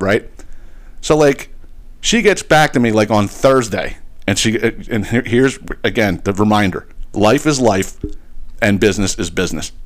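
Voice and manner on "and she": usual speaking voice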